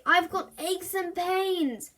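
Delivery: slow, whining complaint; boy faking illness to skip school; whiny wail. A narrator's reading. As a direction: drag out the self-pity. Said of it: "wailed Bertie".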